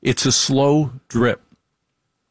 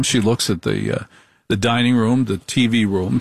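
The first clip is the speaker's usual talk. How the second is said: usual speaking voice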